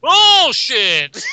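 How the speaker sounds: high, booming shout; loud outburst. Pretend. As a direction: shout it out in one drawn-out burst. Blurts out loud mock objection.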